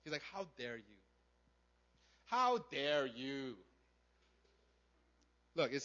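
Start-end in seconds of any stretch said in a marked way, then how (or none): 0.3-1.0 low, quiet, restrained indignation; acted anger, held down low and soft; angry older brother. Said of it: "He's angry at the father". 2.2-3.5 slow, drawn-out acted indignation; angry older brother. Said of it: "He's angry at the father".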